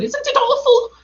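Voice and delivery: very high squeal of dread; terrified Piglet. A narrator's reading. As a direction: squeal it in fright. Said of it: "said Piglet".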